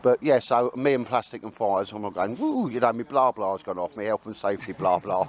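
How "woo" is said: short high-pitched "woo"; high mock "woo"; mock whoop. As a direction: let out a high, playful whoop. Excited whoop in mock story.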